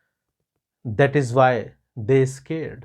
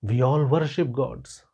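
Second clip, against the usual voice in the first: plain reading voice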